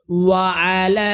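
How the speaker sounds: ওয়া আলা with very long final "লা"; three-alif stretch. Shown as a demonstration. Teacher showing stretch lengths, ওয়া আলা with long held ending. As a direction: keep "ওয়া আ" short and hold লা. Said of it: "তিন আলিফ টান হবে".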